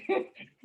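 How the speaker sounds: real laugh breaking into the sentence; short high laugh. Real, not acted. Laughing about her daughter learning the freeway, short laugh.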